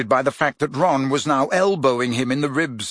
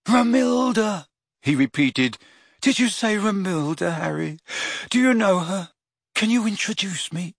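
high dreamy lovestruck voice; 6 semitones higher than usual. Acted. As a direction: swoon over the name. Ron lovestruck.

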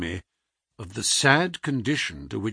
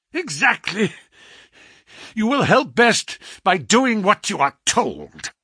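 masterful; 8 semitones higher, 4 dB louder than usual. A narrator's reading.

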